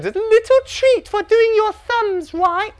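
very high, slow teacher coo; mock a teacher offering little ones a treat; sing-song teacher voice.

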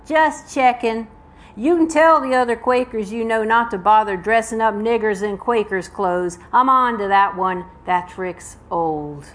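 put-on Southern accent. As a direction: voice a menacing Southern man in a story. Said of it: "you will hear my Southern accent".

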